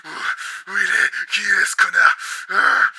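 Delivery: loud, enraged questions; furious shout. Acted. Host raging at the bug that hit his window. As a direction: hunt down the intruder in rage.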